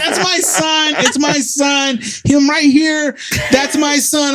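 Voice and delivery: high, pleading mother's voice; mom vouching. Retold or imitated. Mom telling the officers the boy is her son. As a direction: insist he's hers.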